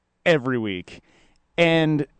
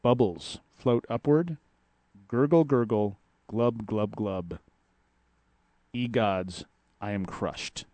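slow, measured haiku reading; poem reading; slower than usual. Acted. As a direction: read each line like a poem. Reads the haikus aloud.

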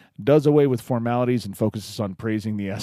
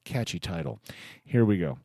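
normal talking voice

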